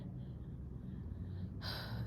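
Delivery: long tired exhale; weary sigh. Real, not acted. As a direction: sigh at the memory. Sigh over the painful shoes.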